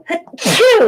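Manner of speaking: real sneeze, loud. Real, not acted. Sneeze announced then done.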